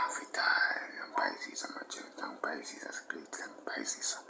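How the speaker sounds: hushed, secretive whisper. Acted. Sudden hushed confession to the guys.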